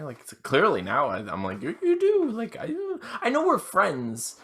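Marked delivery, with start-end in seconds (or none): 1.6-4.4 high, tense little answer; high squeaky voice. Retold or imitated.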